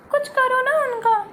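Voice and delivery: ordinary delivery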